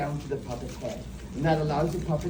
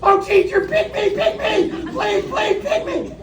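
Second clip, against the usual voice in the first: much higher, louder, begging to be picked; high-pitched pleading child; eager first grader; 5 dB louder than usual. Acted. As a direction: squeal like a keen little kid waving a hand. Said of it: "first grade".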